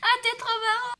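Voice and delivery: shrill laugh, high voice. Acted. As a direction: laugh through it.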